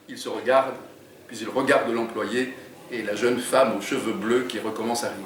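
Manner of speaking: lively read-aloud narration; story reading. A narrator's reading. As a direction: read the chapter to draw listeners in. Author reads his book aloud.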